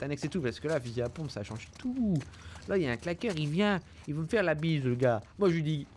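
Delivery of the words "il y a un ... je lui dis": ordinary talk, nothing marked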